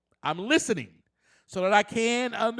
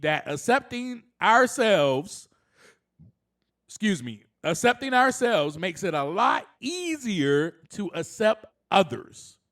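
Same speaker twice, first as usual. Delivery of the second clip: normal talking voice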